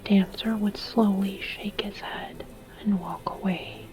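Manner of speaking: plain reading voice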